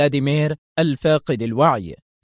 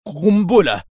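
shaky, falling answer, a bit louder; trembling voice; 4 semitones higher, 5 dB louder, slower than usual. A narrator's reading. Qadri answering the doctor, trembling. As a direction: blurt out the word with a quiver. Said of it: "بصوت مرتجف".

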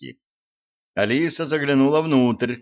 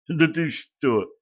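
giggling; 5 semitones higher, slower than usual. Acted. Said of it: "захихикал Сплинтер".

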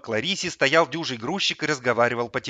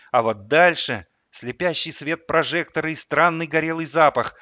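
usual speaking voice

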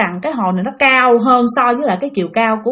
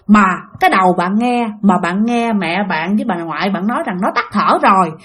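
ordinary talk, nothing marked

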